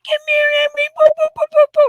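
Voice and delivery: put-on very high cooing baby talk; baby talk to dog. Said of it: "doing baby talk to the dog".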